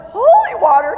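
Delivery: can't believe what you're seeing; incredulous. Retold or imitated. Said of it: "I'm like".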